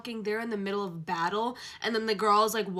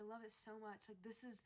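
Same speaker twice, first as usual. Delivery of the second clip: soft breathless aside; hushed; 21 dB quieter than usual. Real, not acted. Fan recovering after squeal, hushed. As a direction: drop to almost nothing. Sudden hush after shriek.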